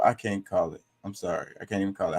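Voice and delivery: ordinary delivery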